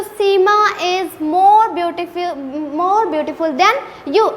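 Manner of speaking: slow teaching example. Shown as a demonstration. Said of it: "मैंने कहा, "You are beautiful".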